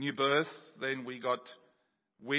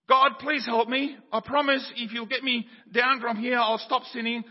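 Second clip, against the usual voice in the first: pleading; 8 semitones higher, 7 dB louder than usual. Acted. Jack pleading from the branch.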